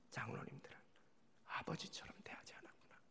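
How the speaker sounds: soft hushed whisper; whispered realization. Real, not acted. Pastor realizing his fault, whispered to himself. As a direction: let the insight come as a breath.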